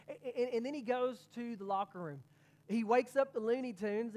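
usual speaking voice

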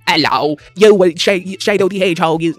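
put-on a clumsy stab at a British accent. Mocking a British interviewer in a botched British accent. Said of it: "oh wait, he has a British accent".

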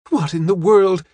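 put-on high, astonished young woman; amazed cry. A narrator's reading. Young woman asks the old lady, amazed high cry. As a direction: burst out with it, pitched right up. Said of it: "she cried".

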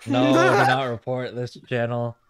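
slow, loud, emphatic plea; loud pleading. Real, not acted. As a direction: drag it out, begging loudly.

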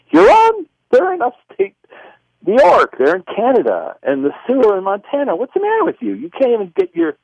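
high-pitched, exasperated outburst; incredulous self-scolding. Retold or imitated. Correcting his own vision's geography, incredulous. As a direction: argue with yourself in high-pitched disbelief. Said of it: "I went, consciously".

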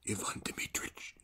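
soft unvoiced plea; whisper. Makar to Askionov at night, whispered plea. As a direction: whisper it close to his ear.

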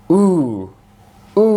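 long drawn-out "উ", as oo; long vowel. Shown as a demonstration. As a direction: hold "উ" as a long oo. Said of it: "অর্থাৎ দীর্ঘ উ".

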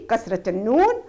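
usual speaking voice

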